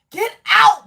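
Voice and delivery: high, loud command; hollered warning to leave; urgent shout. Real, not acted. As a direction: punch both words hard. Urging viewer to flee relationship.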